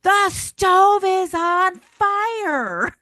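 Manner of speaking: high, loud, drawn-out alarm; slow and loud, finally getting heard; finally yelling. Retold or imitated. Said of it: "finally she just goes".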